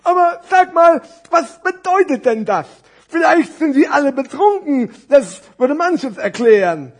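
high, loud storybook musing; animated puppet-show voice; puzzled fat scarf. Acted. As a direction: ask with exaggerated curiosity. Scarf speculates.

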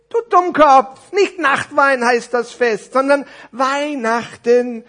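high, loud, drawn-out rebuke; hammed-up character anger; exasperated necktie scolding. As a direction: spell it out slowly to a dimwit, very loud.

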